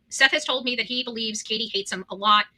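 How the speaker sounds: ordinary delivery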